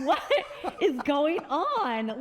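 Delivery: slow, high, laughing bewilderment; incredulous question breaking into laughter; baffled disbelief. Retold or imitated. Retelling her confusion about pandemic.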